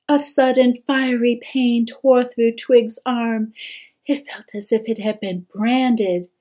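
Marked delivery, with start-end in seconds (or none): none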